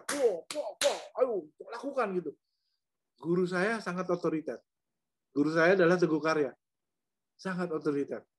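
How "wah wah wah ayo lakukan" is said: lightly voiced impatient parent, pitched up and pushing; mock-hurry an actor like a strict father; bossy authoritarian parent. Director imitating an authoritarian parent urging actors on, lightly mock bossy. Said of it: "sebagai orang tua yang otoriter".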